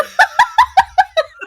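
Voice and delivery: real high cackle; high loud laugh. Real, not acted. Cracking up after the shipping joke, high loud laughter. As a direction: burst out laughing, high-pitched.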